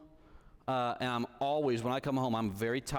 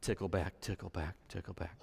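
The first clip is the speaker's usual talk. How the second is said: softer and softer, lower; fading to hush; 7 semitones lower, 6 dB quieter than usual. Real, not acted. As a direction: let each tickle back fade away. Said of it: "soothing narration fading".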